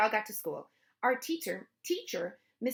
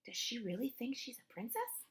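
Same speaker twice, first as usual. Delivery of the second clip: soft, gossipy whisper; whispered aside; 3 semitones higher, 8 dB quieter than usual. A narrator's reading. Friend gossiping across the bus seat, soft whisper. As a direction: lean in and whisper it softly. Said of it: "She leaned over and whispered".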